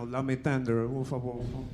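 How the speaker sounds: low, soft sleepy-dog croon. Acted. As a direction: murmur it like a dreaming dog doing an impression. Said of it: "his lip is doing [name]".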